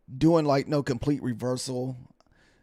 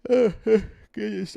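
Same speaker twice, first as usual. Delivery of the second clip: yawning; 7 semitones higher, 4 dB louder than usual. Real, not acted. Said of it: "I cannot stop yawning tonight".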